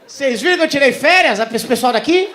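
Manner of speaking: loud, high stage holler; excited shouting. Real, not acted. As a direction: shout it out to the whole crowd. Comedian excitedly opens vacation bit.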